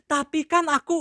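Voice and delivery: high, whiny protest; whiny excuse. Pretend. Preacher imagining David making excuses, high and whiny. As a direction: pretend David dodging. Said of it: "Coba Daud berdalih waktu itu".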